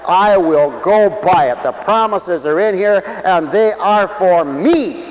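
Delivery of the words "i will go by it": ordinary talk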